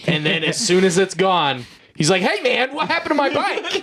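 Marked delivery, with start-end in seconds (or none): 2.2-3.8 much higher squeaky mock panic, a laugh creeping in; upset game character. Game guy asks the player where his bike went, in a high mock panic. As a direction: play the bike owner freaking out in a high voice.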